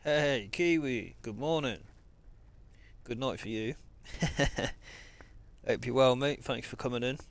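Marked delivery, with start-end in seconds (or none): 3.8-5.6 short chuckle; a real, breathy run of chuckles; laugh. Real, not acted. Streamer greeting a viewer in chat, short chuckle.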